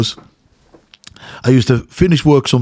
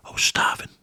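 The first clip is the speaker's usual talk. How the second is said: whisper; 10 dB quieter than usual. Retold or imitated. Recalling boyhood hunger.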